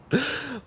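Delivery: high, soft breathy giggle; a small relieved giggle; a quiet high giggle; soft giddy laugh. Acted. Relieved after confession.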